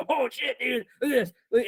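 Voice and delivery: high, excited retold exclamation; excited discovery. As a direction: imitate a kid thrilled by his find.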